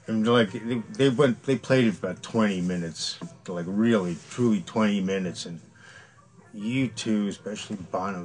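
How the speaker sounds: ordinary talk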